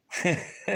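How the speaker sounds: short, high, airy laugh; high chuckle of amazement; overwhelmed laugh. Real, not acted. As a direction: let out a small laugh of disbelief. Overwhelmed at his dream fulfilled.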